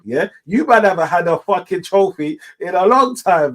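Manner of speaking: normal talking voice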